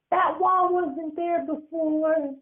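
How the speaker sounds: put-on high slow kid voice; a child spooked by the wall; high, drawn-out childlike worry; uneasy little boy.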